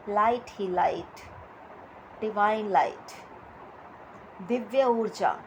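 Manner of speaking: normal talking voice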